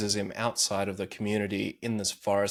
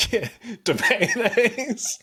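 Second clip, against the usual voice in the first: laughing; 13 semitones higher, 3 dB louder, much slower than usual. Real, not acted. Cracking up at a joke.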